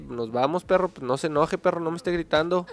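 ordinary delivery

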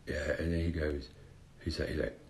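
usual speaking voice

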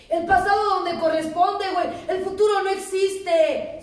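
loud, high, full-force sermon; preaching. Acted. Speaker exhorting fellow AA members, preaching loud and high. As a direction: hammer it home to the room.

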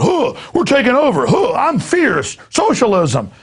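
high-pitched mock militant chanting; hammed-up chant with grunted huhs; mock uniformed kids. Host mocking red-uniformed kids dancing around, hammed-up high chant. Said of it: "kids in red uniforms dancing around like going".

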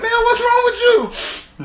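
very high, loud, drawn-out disbelief; squealed, exasperated question; incredulous demand. Retold or imitated. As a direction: confront a friend in comic alarm. Confronting sniffling friend.

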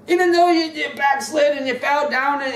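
preaching. Acted. Preaching at full force.

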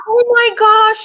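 high, loud exclamation through laughter; real laughing outburst; laughing embarrassment. Real, not acted. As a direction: burst out laughing in mortification. Laughing at her own story.

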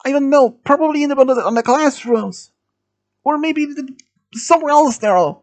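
high-pitched frightened guessing; panicked guessing. A narrator's reading. Student under threat guesses where Lisa is, panicked. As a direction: keep it high and flustered.

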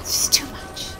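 a breathy half-voiced give-up; defeated whisper. Real, not acted. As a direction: whisper it, overwhelmed and beaten. Overwhelmed in game.

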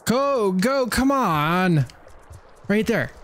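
high, eager "go, go"; excited urging. Real, not acted. Urging on during game danger.

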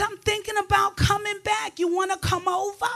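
high, soft flirty put-on voice; mock seductive high voice. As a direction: lure him over sweetly. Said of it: "I said".

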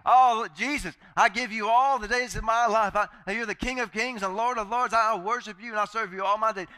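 high, loud, fervent believer; hammed-up pleading worship, voice up; loud fervent praise.

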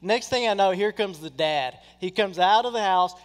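normal talking voice

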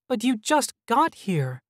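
high-pitched pout; pouting child. A narrator's reading. Child hologram begging visitors to stay, pouting and high. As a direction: protest like a pouty little boy. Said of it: "Oz's lower lip stuck out".

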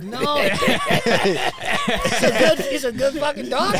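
loud, high, through real laughter; helpless laughing defense; laughing protest. Real, not acted. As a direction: insist while cracking up. Defends doctor while everybody laughs.

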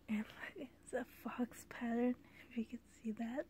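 soft hushed whisper. Real, not acted. Vlogger showing a fox-pattern hat up close, whispering. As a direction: breathe it like sharing a secret.

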